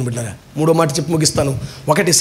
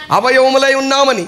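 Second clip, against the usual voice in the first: higher, solemn verse reading; scripture reading; 7 semitones higher than usual. Acted. Preacher quoting the verse to his listeners, high solemn reading. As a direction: proclaim the verse line.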